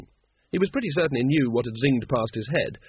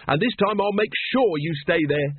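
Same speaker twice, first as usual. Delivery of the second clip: high-pitched, vindictive promise; gloating threat; 5 semitones higher than usual. A narrator's reading. Once threatens the patrician with the cells, gloating. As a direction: make the threat stick, pitch lifted. Villain gloats over his prisoner.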